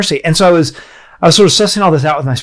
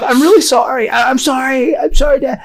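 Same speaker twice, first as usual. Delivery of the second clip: higher, lightly voiced little daughter apologizing; imitating sorry little girl; 10 semitones higher than usual. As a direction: sound like a small girl saying sorry to her dad. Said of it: "And she said".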